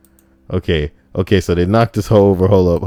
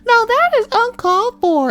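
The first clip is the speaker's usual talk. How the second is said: high prim scolding lady; mock high-pitched disapproving lady; 3 dB louder than usual.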